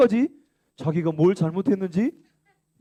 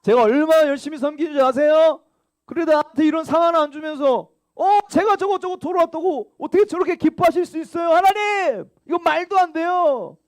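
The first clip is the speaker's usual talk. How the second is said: high, loud aggrieved protest, climbing higher; indignant whining; 10 semitones higher, 5 dB louder than usual. Acted. Preacher playing a jealous churchgoer, higher and louder. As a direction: complain hard that it isn't fair. Acting the older son's complaint.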